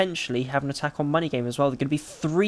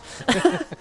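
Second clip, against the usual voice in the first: sudden high giggle; high burst of laughter; 10 semitones higher than usual. Real, not acted. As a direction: break into a squeaky laugh. Laughs at own remark.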